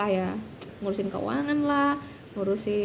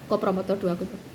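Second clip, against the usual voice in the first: ordinary delivery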